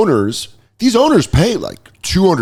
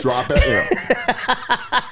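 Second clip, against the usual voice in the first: mock high; 11 semitones higher, much slower than usual.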